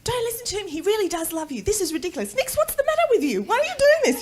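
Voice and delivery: high, rising mock scolding; scolding mother. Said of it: "my mother reprimanded him all the way home".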